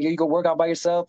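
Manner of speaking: a lightly voiced curious fellow inmate; curious inmate friend. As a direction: voice pitched well up, asking eagerly. Friend voiced in a prison story.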